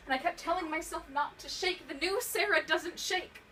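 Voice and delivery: loud, high, insisting against the fear; agitated self-command, pitched high; rising panic. Acted. Panic after the phone call.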